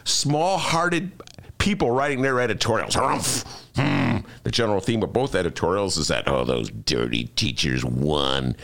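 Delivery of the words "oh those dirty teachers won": mock sneer. Mocking editorial writers' view.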